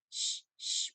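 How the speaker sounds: whispered shh like a shaker; shaker sound. Shown as a demonstration. Teacher imitating the shaker for the class, whispered shh. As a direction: hiss out the shaker strokes.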